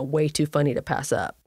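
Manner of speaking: plain reading voice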